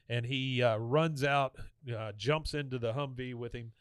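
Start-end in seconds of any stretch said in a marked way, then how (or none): none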